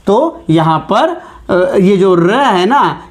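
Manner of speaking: ordinary delivery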